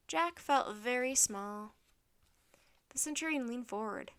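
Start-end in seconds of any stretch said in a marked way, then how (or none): none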